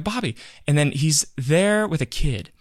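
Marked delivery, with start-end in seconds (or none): 0.0-0.3 high, short call for attention. Retold or imitated. Fan approaching a comedian he admires, calling out. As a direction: lightly hail him by name, without yelling. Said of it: "and I'm like".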